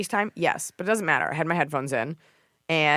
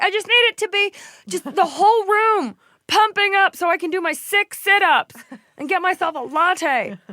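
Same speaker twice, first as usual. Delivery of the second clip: high airy mocking voice of a client; ditzy gym client; 13 semitones higher, 5 dB louder than usual. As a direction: parody a clueless gym-goer. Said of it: "your client's like".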